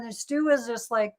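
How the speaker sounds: normal talking voice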